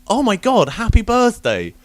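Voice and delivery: high, loud, eager birthday wishes; gushing fan. Retold or imitated. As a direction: gush over your hero. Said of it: "it fucking dawns on me".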